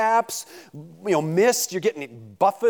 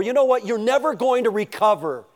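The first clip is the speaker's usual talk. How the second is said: taunting jeer; 6 semitones higher than usual. Retold or imitated. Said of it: "the taunts of your giants".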